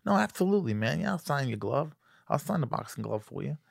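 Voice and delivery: high, soft imitation of Mike Tyson; Mike Tyson impression. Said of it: "He goes".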